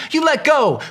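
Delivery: high, loud panicked cry; frantic cry. Acted. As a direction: shriek at him to let go. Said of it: "she cried".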